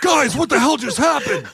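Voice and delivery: high-pitched imitation of baffled shouting; mock bewildered outcry. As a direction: cry it out as a comically baffled wrestler. Mimicking a bewildered wrestler.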